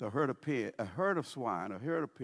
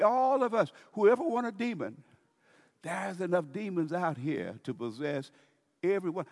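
preaching call; 6 semitones higher, 4 dB louder than usual. Real, not acted. Preaching at full force.

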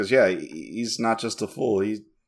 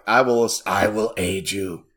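slow, put-on creature-hero voice; solemn heroic figure; slower than usual. Baraka offering help to strangers, mock solemn. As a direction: voice a grand pledge, drawn out. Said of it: "man, he's just like".

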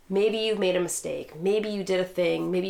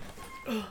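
sharp high gasp; startled gasp at a spill; big gasp; 10 dB quieter than usual. Real, not acted. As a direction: gasp in shock.

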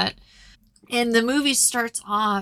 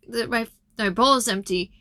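ordinary talk

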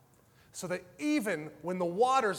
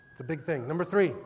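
ordinary delivery

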